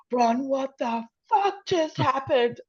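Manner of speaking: high, slow, aghast imitation; stunned disbelief. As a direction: draw out a shocked colleague's question. Retold shocked fellow comedian.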